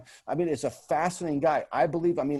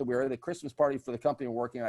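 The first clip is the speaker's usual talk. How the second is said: usual speaking voice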